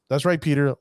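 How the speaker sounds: normal talking voice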